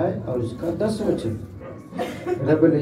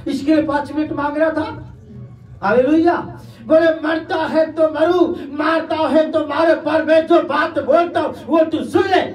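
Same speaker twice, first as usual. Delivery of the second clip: high, loud, sustained pulpit cry; impassioned preacher acting out a defiant martyr; full-force preaching; 10 semitones higher, 3 dB louder than usual. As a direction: drive each phrase home at a raised pitch.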